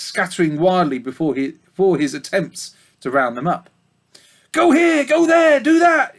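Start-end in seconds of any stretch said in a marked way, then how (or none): 4.5-6.1 high, loud piglet squeak ordering; furious squeak. Acted. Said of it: "he squeaked furiously at them".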